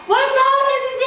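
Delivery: high, loud singsong greeting; mock teenagers hollering hello to the cool mom; excited teen greeting. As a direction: sing it out high like a gushing kid. Imagined teens greet cool mom.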